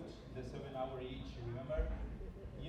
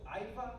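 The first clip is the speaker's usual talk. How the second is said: higher, rising questioning echo; puzzled questioning; 6 semitones higher than usual. Retold or imitated. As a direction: imitate someone unsure they heard the name. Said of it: "and they go".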